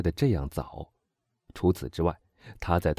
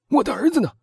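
loud, high alarmed outburst; sudden shout; 6 dB louder, faster than usual. A narrator's reading. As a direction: burst out in panic for your son. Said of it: "他突然喊道". (突然惊慌地喊叫)

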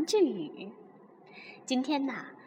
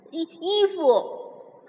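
hesitant answer; 5 semitones higher, 4 dB louder, much slower than usual. Acted. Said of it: "哈瓦尔答道".

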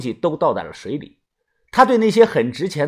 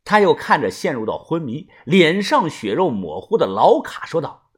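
ordinary delivery, nothing marked

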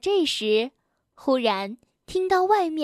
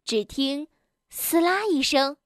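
plain reading voice

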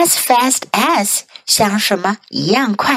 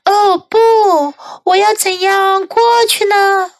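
crying out; 10 semitones higher than usual. A narrator's reading. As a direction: cry out in alarm, worried. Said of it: "他叫了起来".

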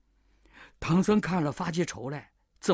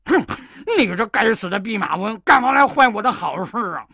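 louder angry scolding; 3 semitones higher, 7 dB louder than usual. Acted. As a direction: bark at the monkey in a rage. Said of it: "那妖怪气得".

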